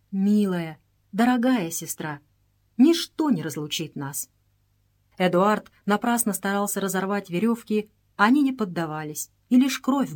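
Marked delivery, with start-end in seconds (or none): none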